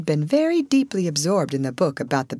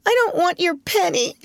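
high, tearful refusal; upset little girl, pitched high; sobbing child; 14 semitones higher than usual. A narrator's reading. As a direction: whine it through acted sobs. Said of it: "sobbed Lottie".

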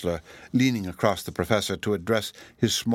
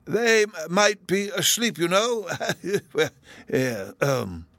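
put-on high, slow, stammering old academic; absent-minded high character voice; dithering other professor; 10 semitones higher, slower than usual. A narrator's reading. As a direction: falter and chuckle between words.

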